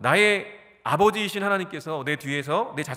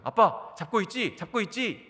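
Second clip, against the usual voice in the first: put-on higher, anxious child asking again and again; scared son calling dad; 6 semitones higher than usual. Son on the bike calls to his dad, higher and anxious. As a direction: play the boy wobbling on his bike, calling back to dad. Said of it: "아빠인 저를 부르면서".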